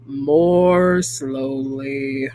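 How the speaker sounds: drawn-out deep voice; low and slow. Shown as a demonstration. Teacher explains slow vibration.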